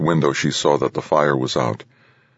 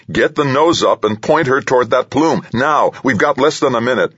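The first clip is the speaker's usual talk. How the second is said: high-pitched, insistent command; urgent demand; 10 semitones higher, 4 dB louder than usual. A narrator's reading. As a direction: demand it forcefully, voice raised high. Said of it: "Hartunian demanded".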